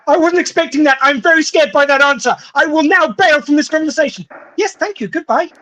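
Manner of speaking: mock-scared English retreat; polite panic. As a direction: bail out of the chat politely. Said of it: "us going".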